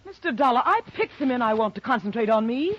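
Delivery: sharp, loud and indignant; offended widow snaps at the detective; angry outburst. Acted. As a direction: bite back at the insult. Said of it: "short on your temper".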